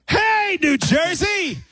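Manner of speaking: high yelled concert greeting; hype shout. Retold or imitated. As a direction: shout it to the stadium. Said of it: "he goes".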